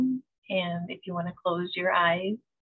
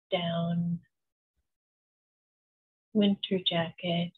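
slow, even meditation voice with long pauses; unhurried, soothing visualization, letting each phrase settle; guided meditation. Acted. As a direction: guide them gently into calm, phrase by phrase.

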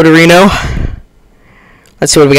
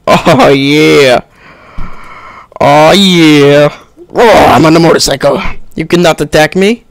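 normal talking voice

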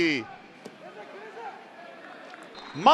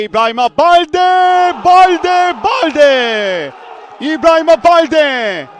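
loud high scorer's name chant; screaming football commentator; 3 semitones higher, 6 dB louder, slower than usual. Real, not acted. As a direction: shout the scorer's name again and again. Goal scorer name called out.

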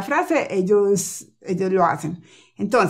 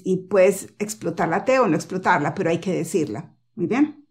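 ordinary talk, nothing marked

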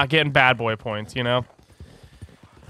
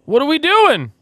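much higher and louder baffled cry; exasperated; 12 semitones higher, 6 dB louder than usual. Real, not acted. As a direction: throw it out in disbelief. Louder repeat of question.

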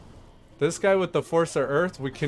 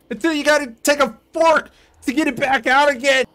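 much higher, louder and fast, a silly cartoon voice; mock squeaky voice; 11 semitones higher, 10 dB louder, faster than usual. Mocking the game character, squeaky cartoon voice. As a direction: rattle it off in a squeaky caricature.

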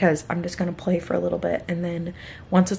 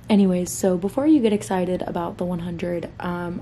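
usual speaking voice

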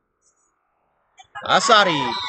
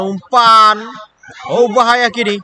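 excited call; 6 semitones higher, 6 dB louder, slower than usual. Real, not acted. Attack builds toward goal.

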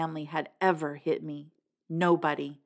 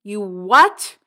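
loud incredulous shout; shouted outrage at the answer; 3 semitones higher, 9 dB louder than usual. A narrator's reading. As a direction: bark the disbelief. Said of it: "He was shouting".

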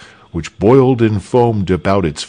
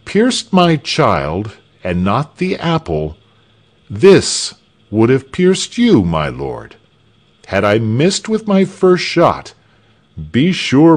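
higher-pitched, emphatic bold declaration; raised, resolute hero's voice; defiant archer; 7 semitones higher, 4 dB louder than usual. A narrator's reading. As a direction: confess it proudly, driving home the threat.

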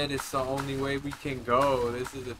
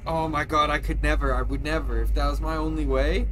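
shocked; 2 semitones higher, 9 dB louder than usual. Real, not acted. Reacting to scary drop.

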